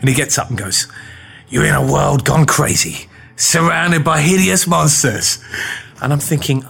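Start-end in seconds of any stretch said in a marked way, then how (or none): 1.5-5.4 epic trailer read, hideous stretched slow; movie trailer voice. Shown as a demonstration. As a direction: sell it like a blockbuster trailer. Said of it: "It's gotta be like this".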